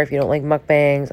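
ordinary talk, nothing marked

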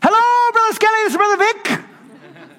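very high, loud, eager phone greeting; excitable Brother Vic. Retold or imitated. Vic calling the pastor years later, overjoyed. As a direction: greet him like a long-lost friend. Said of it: "He was an excitable kind of guy".

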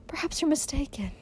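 high, hushed, tentative plea; small high frightened voice; timid pleading. A narrator's reading. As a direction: venture it timidly, almost whispering. Timid mother pleads with stern husband.

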